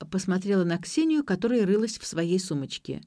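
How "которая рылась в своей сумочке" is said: ordinary delivery, nothing marked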